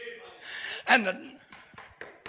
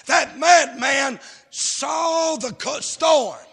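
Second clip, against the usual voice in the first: raised, forceful pulpit voice; fervent preaching; 4 semitones higher, 6 dB louder, slower than usual. Real, not acted. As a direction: build into a loud, drawn-out proclamation.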